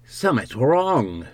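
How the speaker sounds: lightly voiced country innkeeper; uneasy countryman. Rustic Hall voices his suspicion.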